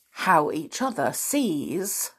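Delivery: ordinary talk, nothing marked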